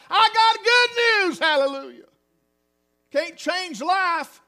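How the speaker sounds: loud, slow, high proclamation; slow, soaring preacher's cry; full-force preaching. Acted. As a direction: ring it out over the congregation.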